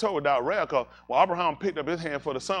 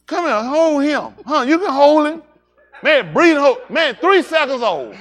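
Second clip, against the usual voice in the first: excited family voices; 10 semitones higher, 13 dB louder than usual. Retold or imitated. Relatives fussing over a newborn.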